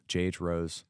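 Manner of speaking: ordinary talk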